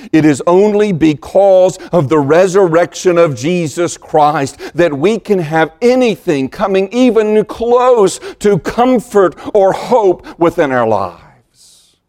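ordinary talk

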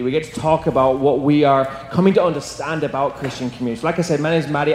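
ordinary delivery

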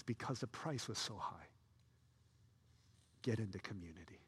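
hushed right version; the true reading. Shown as a demonstration. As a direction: drop low and sincere for the real message. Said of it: "He says".

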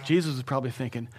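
ordinary talk

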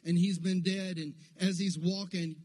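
normal talking voice

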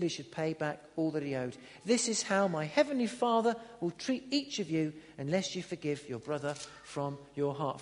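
measured Bible reading with acted voices; scripture reading. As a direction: read the parable to the church. Reads the parable aloud.

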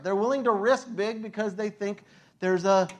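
usual speaking voice

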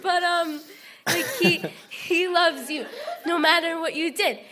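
real laughter through the words; laughing. Real, not acted. Laughing at herself as she tells the group how God loves them. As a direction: still laughing at herself after going Brooklyn. Laughing at her own Brooklyn slip.

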